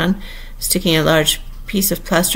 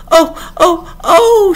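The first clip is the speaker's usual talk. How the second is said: high, loud repeated cries; screamed "oh"s; 12 semitones higher, 6 dB louder, much slower than usual. A narrator's reading. Queen screams before her finger is pricked, shrieking high. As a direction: shriek each "oh" like a steam whistle. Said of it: "the Queen began screaming so loud".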